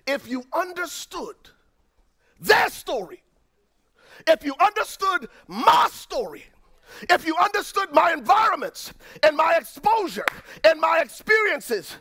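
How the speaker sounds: high, loud preacher's cry; impassioned preaching shout. Real, not acted. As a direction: lift it into a pleading shout to the congregation. Preacher builds to impassioned climax.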